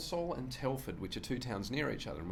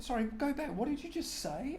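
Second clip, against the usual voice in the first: incredulous. Retold or imitated. Comedian asking crowd repeat.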